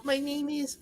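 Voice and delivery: tiny, high, very soft squeak; little hamster voice. Retold or imitated. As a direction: imitate a timid student introducing themselves. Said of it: "you sound like a little, like, hamster".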